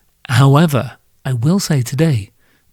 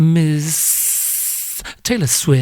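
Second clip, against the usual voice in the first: slow, drawn-out showbiz introduction; grand announcer reveal; much slower than usual. Acted. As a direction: stretch it out like a red-carpet announcer. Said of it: "no clue why I said it like that".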